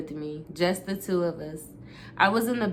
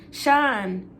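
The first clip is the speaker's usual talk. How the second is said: pleading; 5 semitones higher, 4 dB louder than usual. A narrator's reading. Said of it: "I tried to explain".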